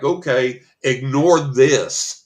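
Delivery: dragged-out loud "ignore this"; mock ignore-me. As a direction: drag out "ignore" loudly. Spirit ramping up, mock demand.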